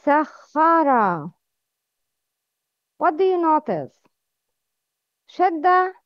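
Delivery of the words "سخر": سخر slow with a doubled, held kh; slow model word. Shown as a demonstration. Teacher shows pupils the shadda, slow and deliberate. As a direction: lean on the shadda in سخر slowly. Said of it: "Notice where is the place".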